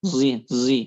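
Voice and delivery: zi said with a sad blended toward z; isymam zi sound. Shown as a demonstration. Teacher drilling the sad-zai blend: zi, zi. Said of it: "kita mengucapkan dha".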